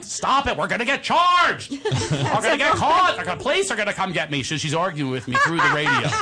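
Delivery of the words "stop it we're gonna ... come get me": higher, louder panicked mom; mom's voice. Retold or imitated. Son imitating panicked mom begging him to hush, higher and louder. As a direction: plead like a mother scared of the police. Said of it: "it's very accurate".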